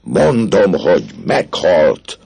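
loud, high, slow, rasped-out cry; desperate rage. Acted. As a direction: hurl it back in despair. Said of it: "Kétségbe esett düvel hörögte".

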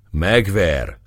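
ordinary delivery

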